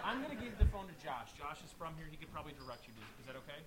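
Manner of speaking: distant phone voice; speaking off mic; far from mic. Real, not acted. Talking into the phone away.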